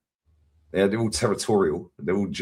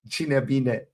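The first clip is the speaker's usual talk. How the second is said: higher-pitched mimicked Romanian phrase; imitated Romanian; 5 semitones higher than usual. Repeating overheard words to a friend, in imitated Romanian. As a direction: put on a Romanian speaker's voice. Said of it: "slip back to Romanian real quick".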